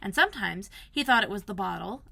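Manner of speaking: usual speaking voice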